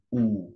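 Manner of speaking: "wu" short and low, no rise; low half third tone. Shown as a demonstration. Teacher corrects the count, "wu" only low. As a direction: keep "wu" down at the bottom. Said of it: "U-nya langsung cuman rendah doang".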